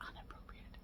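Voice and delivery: breathy whispered repeat of inappropriate. Shown as a demonstration. Vlogger to viewers, whispering the word for emphasis. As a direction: drop to a hush on "inappropriate".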